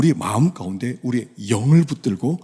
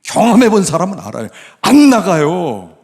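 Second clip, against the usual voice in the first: loud, raised, emphatic insistence; forceful preaching; 5 semitones higher, 9 dB louder than usual. Real, not acted. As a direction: hammer it home to the congregation.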